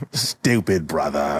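slow, low grudging repeat; bitter old Pork. Acted. Old Pork on his inventor brother, bitter. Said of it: "He's old and bitter".

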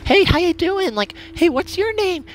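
high mock-friendly girl voice; overly nice girl. Girl chatting up a new party member, mock-nice. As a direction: put on her sweet act, pitched right up. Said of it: "She's acting, like, all nice".

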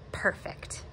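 whispered, unvoiced perfect; a murmured whisper of Perfect; soft breathy perfect. A narrator's reading. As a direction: breathe it out softly as Dad. Dad voiced in read story.

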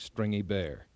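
ordinary delivery, nothing marked

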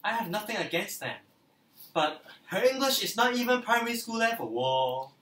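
mocking. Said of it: "They say things like".

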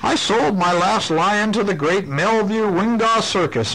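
Mister Rumple. Acted. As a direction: read it high as the pet-store owner. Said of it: "said Mister Rumple".

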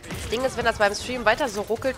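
ordinary talk, nothing marked

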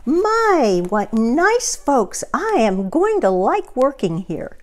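a cheerful housekeeper pleased with her new job; Amelia Bedelia. A narrator's reading. Amelia Bedelia admiring her employers as they leave, delighted. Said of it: "said Amelia Bedelia".

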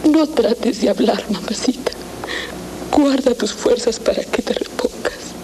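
tender pleading. Acted. Daughter at dying mother's side.

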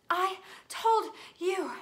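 high, slow, loud gasped reproach; gasping. A narrator's reading. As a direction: gasp each word past a stitch. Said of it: "Hermione gasped, clutching at the stitch".

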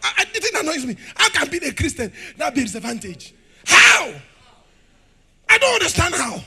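loud, high, shouting preacher; fiery preaching. Acted. Preacher rouses church about dominion, shouting loud and high. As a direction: hammer each line at the congregation.

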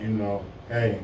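ordinary talk, nothing marked

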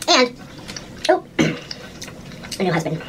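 soft slow joking aside; hushed, drawn-out joke; mock whisper aside.

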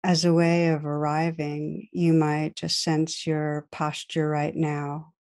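calm guided meditation voice with long pauses. Real, not acted. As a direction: guide listeners gently inward, leaving space to breathe.

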